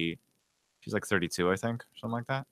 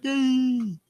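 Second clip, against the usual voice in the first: imitating the video voice. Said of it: "that voice that speaks like that".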